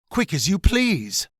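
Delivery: usual speaking voice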